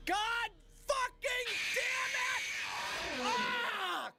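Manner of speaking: furious yell. Real, not acted. Gamer screaming at the game after failing, furious yell. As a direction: explode at the game in frustration. Raging at a failed game run.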